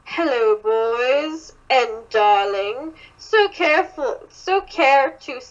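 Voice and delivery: put-on British accent, drawn out; slow, teasing British villain voice. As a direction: read it as a British character. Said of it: "She's over here doing a British accent".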